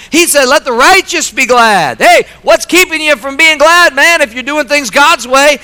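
high, loud, building exhortation; preaching at full force. Acted. Pastor urging the church to rejoice, high and loud. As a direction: drive it home to the congregation.

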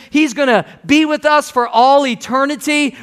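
normal talking voice

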